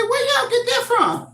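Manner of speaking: high, loud teasing rebuke to the grandkids; mock scolding grandma. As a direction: playfully call out the smarty pants. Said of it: "I say".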